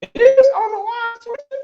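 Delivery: high surprised exclamation. Real, not acted. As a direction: react in startled disbelief. Host shocked at glitch.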